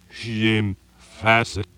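reversed tape played forward, slow; slow, warped reversed recording of a name; backwards speech. Shown as a demonstration. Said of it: "reverse the tape and play that forward again".

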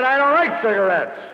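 losing his cool and raising his voice. Retold or imitated. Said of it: "And in a still louder tone of voice".

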